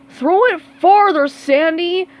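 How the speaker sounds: high, loud bark; barked order. Acted. Squidward barking at Sandy during javelin, loud. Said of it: "barked Squidward".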